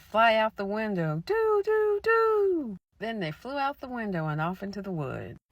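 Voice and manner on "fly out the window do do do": loud command rising into high hoots; eerie commanding voice. Acted. Mysterious voice casting witches out, loud then high. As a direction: order them out like a spell. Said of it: "and the voice said".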